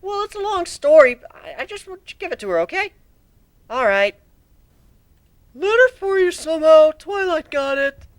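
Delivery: put-on very high, loud young dragon voice; little dragon calling at a door; eager Spike.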